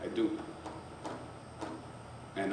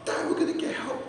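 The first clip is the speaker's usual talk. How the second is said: put-on high, eager kid's voice; bursting with the news, like a boy; excited son. Son repeating news on phone.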